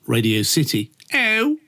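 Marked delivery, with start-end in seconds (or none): 1.1-1.6 high, hammed-up Scouse; mock Liverpudlian, pitched high; Liverpool accent. Shown as a demonstration. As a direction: put on a bad Liverpool accent. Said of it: "that's my Liverpool accent".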